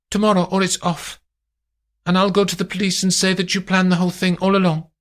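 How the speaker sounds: high, fast threat; high voice, menacing; threatening lady. Acted. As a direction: press the threat quickly. Amandine threatening.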